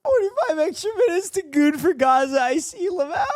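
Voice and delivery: high, laughing delivery; barely getting it out for laughing; laughing through words. Real, not acted. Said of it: "But Keko goes".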